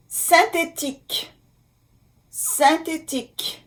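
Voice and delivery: "Synthétique" in French, nasal "syn-", crisp "tique"; careful French pronunciation. Shown as a demonstration. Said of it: "Y N".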